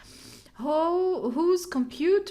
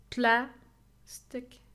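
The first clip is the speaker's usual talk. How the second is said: plastic split slowly, PLAS held stronger than tic; very slow plastic, stressed at the start; first-syllable stress. Shown as a demonstration. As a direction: break plastic in two, weight on PLAS. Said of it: "I think it's the first one".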